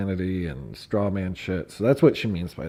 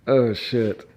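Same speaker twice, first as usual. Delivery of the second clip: usual speaking voice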